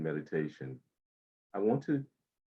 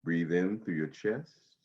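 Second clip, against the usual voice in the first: guided meditation. Acted. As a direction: ease them into calm breathing. Guides body-scan meditation.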